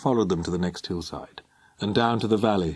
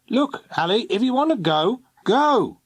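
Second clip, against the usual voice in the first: much higher, rising louder to a final "go"; exasperated snap; 13 semitones higher, 4 dB louder than usual. Acted. Stepfather telling Alison to just go, rising exasperation. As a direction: let it build to an impatient dismissal. Exasperated man snapping at girl.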